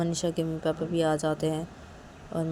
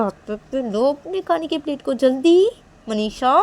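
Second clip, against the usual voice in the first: loud, higher, furious nagging; angry scolding; 6 semitones higher, 11 dB louder than usual. Acted. Girl forcing her upset friend to eat, angry loud scolding. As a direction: bark orders at her to eat now.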